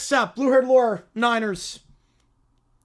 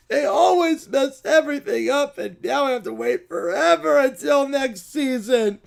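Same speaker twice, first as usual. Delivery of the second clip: crying bear. Acted. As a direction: sob that the season is ruined. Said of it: "Bears fans really do cry a lot".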